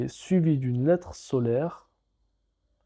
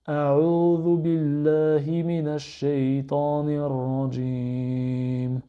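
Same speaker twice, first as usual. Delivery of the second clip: careful Arabic recitation at even pace. Shown as a demonstration. Teacher repeating the phrase for learners, clear recitation. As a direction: recite it clearly for students to copy. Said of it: "Je répète".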